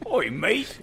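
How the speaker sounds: hammed-up cockney greeting; British accent. Host doing her only British accent, laughing cockney. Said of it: "That's the only British accent I".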